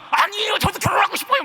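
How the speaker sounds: high, loud protesting yell; yelling at mother. Retold or imitated. Son yelling at his mother about marriage, loud and high. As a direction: shout it back like a frustrated son. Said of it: "막 소리 지르고 집에서는".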